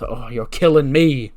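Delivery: slow, drawn-out mock groan of words; acted exasperation. Tony reacts to Truth's Killings joke, exasperated. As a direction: stretch it out, exasperated.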